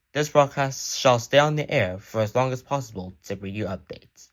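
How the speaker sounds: normal talking voice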